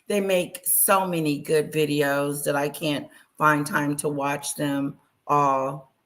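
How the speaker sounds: normal talking voice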